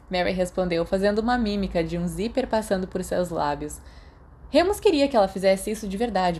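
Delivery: usual speaking voice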